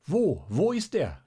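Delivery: high, loud alarmed call. A narrator's reading. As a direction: shout the question to the crowd. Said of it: "riefen wieder andere Stimmen". (hoher, lauter Ruf)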